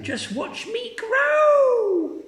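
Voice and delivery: put-on very high, slow singsong old woman; Grandma shouting. Grandma crowing to George, very high singsong shout. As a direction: sing it out as she shoots up.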